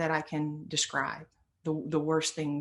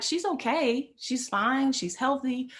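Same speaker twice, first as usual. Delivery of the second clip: higher, breezy it's all fine; light reassurance; 7 semitones higher, slower than usual. Retold or imitated. Telling the practice player mom is fine, lightly reassuring. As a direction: put his mind at ease.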